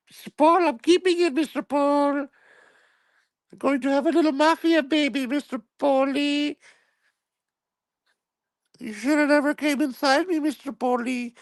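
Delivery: very high falsetto with a Spanish lilt; squeaky lovesick maid; mock maid voice. Maid Gloria pleading with her boss in high falsetto.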